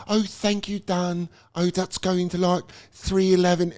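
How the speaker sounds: high, soft put-on British accent; fake British accent. Waiter recalls the hostess thanking him, in a soft fake British accent. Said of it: "she had a British accent".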